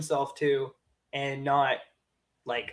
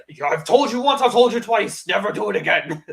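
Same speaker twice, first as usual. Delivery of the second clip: higher, louder put-on warning; imitating Carnage; 6 semitones higher, 6 dB louder than usual. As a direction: warn with mock menace. Carnage scolding, retold.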